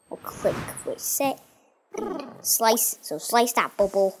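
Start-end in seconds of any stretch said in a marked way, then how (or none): none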